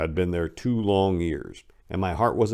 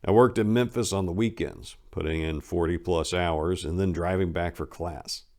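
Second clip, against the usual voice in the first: ordinary delivery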